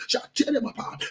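ordinary talk, nothing marked

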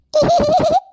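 put-on high little child's giggle; toddler giggle. (കുഞ്ഞിന്റെ ചിരി)

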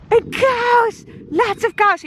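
high, delighted; excited about cows. Real, not acted. Vlogger excitedly points out cows, delighted. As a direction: squeal with joy at the cows.

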